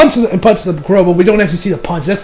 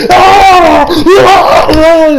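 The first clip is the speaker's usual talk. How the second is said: loud, high imitated scream; mock agonized scream; 10 semitones higher, 6 dB louder, much slower than usual. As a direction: scream out long, like a hurt victim.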